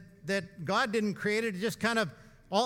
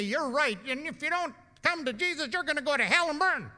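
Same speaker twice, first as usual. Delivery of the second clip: mock hellfire preacher; 5 semitones higher than usual. Pastor mocks a hellfire warning to Captain Dick, hammed up. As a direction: play the condemning preacher he refused to be.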